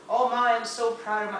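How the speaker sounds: lightly voiced mom making a birthday speech; proud mom's speech. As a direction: play a gushing mom addressing a party. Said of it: "I also need to work on my voice".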